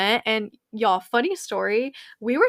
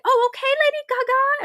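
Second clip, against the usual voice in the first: much higher, meek and startled; cowed fan; 4 dB louder than usual. Retold or imitated. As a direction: squeak it like a scolded fan. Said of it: "we would be like".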